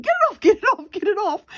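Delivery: high, fast-repeated panicked yelps; panicked cries. Retold or imitated. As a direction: act out a girl frantic to get something off. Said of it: "she was so panicking".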